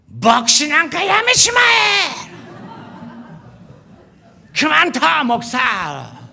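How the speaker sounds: high, loud, slow furious yell; angry shout. Retold or imitated. Pastor voicing his angry second son, a loud furious shout. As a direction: bellow it like an enraged teenage son. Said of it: "의자를 탕 치면서".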